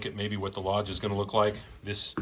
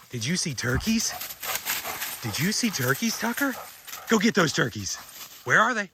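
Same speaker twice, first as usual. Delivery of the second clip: higher-pitched, excited questions; excited dog talk; 8 semitones higher than usual. Real, not acted. Owner urging his dog after turkeys, excited high voice. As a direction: rile up the dog with a high, eager voice.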